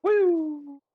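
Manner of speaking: shrill celebratory woo; excited high whoop. Real, not acted. Cheering in game.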